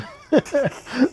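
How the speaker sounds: high, playful burst of laughter; high teasing laugh. Real, not acted. Teasing about Joe.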